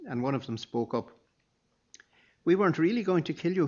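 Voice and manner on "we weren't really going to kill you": put-on higher, louder and fast, a scared boy pleading; shakily. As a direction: rush it out like a frightened teenager. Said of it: "he said shakily".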